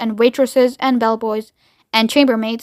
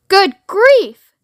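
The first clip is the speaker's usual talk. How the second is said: high, loud exclamation; astonished; 7 semitones higher, 6 dB louder than usual. A narrator's reading. Shanks realising who is speaking, astonished. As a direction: exclaim in sudden amazement.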